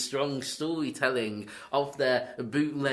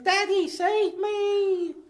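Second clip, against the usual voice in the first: put-on very high, loud child wail; drawn-out squeaky cry for help; Haley Joel Osment; 7 dB louder, much slower than usual. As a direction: plead like a hammed-up little boy in peril. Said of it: "Little Haley Joel Osment acting his little heart out".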